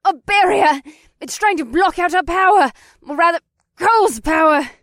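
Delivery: high, loud alarmed shout; shouted at full volume, pitched high; shout over the gale. A narrator's reading. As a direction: yell it over a roaring wind. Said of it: "Penelope shouted over the gale".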